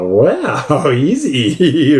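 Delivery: imitated stunned wow, swooping up and down; exaggerated mock astonishment with a laugh; retold shock. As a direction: act out her disbelief, laughing through it. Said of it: "she was full shocked".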